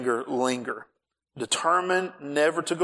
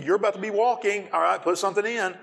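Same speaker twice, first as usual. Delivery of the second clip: husband's mock tirade about the gas light; mock scolding rant; 6 semitones higher than usual. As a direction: ham up the husband's lecture, pitch climbing.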